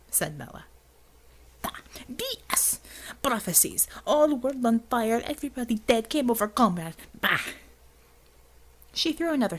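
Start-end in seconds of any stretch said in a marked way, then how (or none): 1.6-7.5 mock the prophecies in thick Russian accent. Xavier scoffing in Russian accent.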